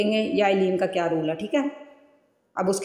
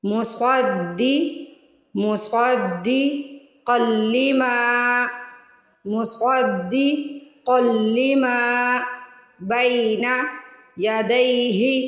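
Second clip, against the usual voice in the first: slow, word-by-word reading; slow word drill; much slower than usual. Shown as a demonstration. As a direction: read each word slowly and clearly. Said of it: "पूरा word पढ़ के".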